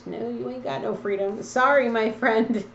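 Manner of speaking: normal talking voice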